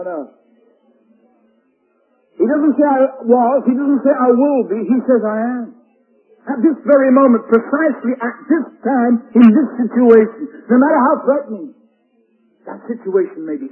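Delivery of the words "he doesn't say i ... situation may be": higher and louder, driving home each phrase; full-force pulpit preaching; fiery preaching. Acted. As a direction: preach it with rising fervour.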